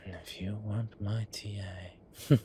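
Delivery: teasing whispered warning. Acted. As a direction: drop to a sly whisper. Half threat to assistant.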